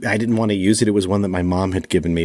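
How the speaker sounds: usual speaking voice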